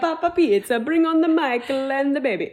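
hammed-up mock Italian, pitched high and loud; playful fake Italian, high and loud. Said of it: "Like, Mamma Mia".

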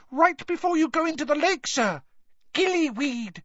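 very high, urgent elf voice; squeaky house-elf urgency; Dobby squeaking. A narrator's reading. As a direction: squeak it out in a panic to hurry him. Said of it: "squeaked the elf".

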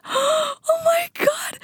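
gasping, very high and drawn out; gasp, then squeak it slowly in mock horror; shocked gasp. Reacting to her friend's follow request, shocked.

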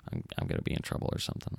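low, fast, hushed whisper; frightened whisper. Retold or imitated. Boy thinking he'll be punished, whispered fast. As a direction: breathe it out in a rush. Recalling scared thought.

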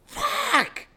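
very high, loud outburst; acted-out yell of frustration; retold frustrated scream. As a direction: let out an exasperated shriek for the story. Retold frustrated shout at boss's order.

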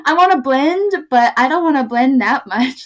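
high, loud words through laughter; giggly high quote; laughing. Retold or imitated. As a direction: laugh out your own dilemma. Quoting herself, laughing.

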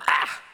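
short burst of mock disgust; balking cry. Preacher acts out someone disliking Jesus' words, balking cry. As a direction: recoil with a sharp "ah". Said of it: "I don't like that".

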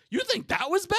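high-pitched disbelief; mock incredulous. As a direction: act out being amazed anyone objected. Acts out disbelief at coworkers' reaction.

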